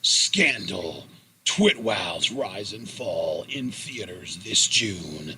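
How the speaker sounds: low, slow movie-trailer voice; trailer narrator. Pretend. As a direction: drop the voice for a dramatic trailer line.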